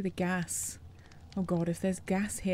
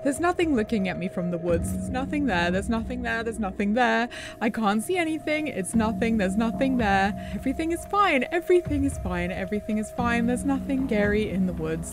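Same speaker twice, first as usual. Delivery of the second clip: louder, rising panicked repetition; mounting panic; 3 semitones higher, 7 dB louder than usual. Real, not acted. Repeating a mantra alone in the woods, panic mounting. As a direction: chant it to convince yourself. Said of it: "I'm not crazy".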